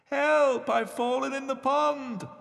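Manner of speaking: high, acted distress call; call for help. A narrator's reading. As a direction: cry out high from the pond. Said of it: "called the voice".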